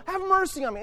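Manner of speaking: high-pitched cry over objections; insistent shouting. Acted. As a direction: keep crying out for mercy. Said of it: "he just keeps shouting it out".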